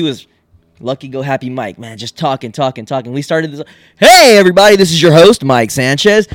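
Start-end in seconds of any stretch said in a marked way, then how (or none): 4.0-6.3 loud, higher-pitched hosting voice, easing off at the name; Mike's podcast voice. Retold or imitated. Co-host mocks Mike opening the show, booming podcast voice. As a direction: boom out an exaggerated show intro. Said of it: "His whole fucking voice changed".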